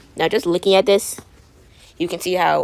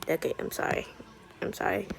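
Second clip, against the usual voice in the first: whispered apology; 12 dB quieter than usual. Pretend. Fausse excuse chuchotée.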